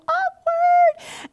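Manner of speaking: very high, loud comic aside; mock-embarrassed high singsong; singsong awkward. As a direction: sing it out high and drawn. Singsong comment on quarreling couple.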